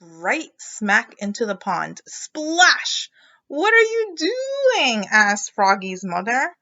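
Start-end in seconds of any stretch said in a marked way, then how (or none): 2.1-3.1 high, loud splash; dramatic splash. A narrator's reading. Sound word. 3.5-5.1 high, loud, slow alarmed cry; mother crying out at Froggy. Acted.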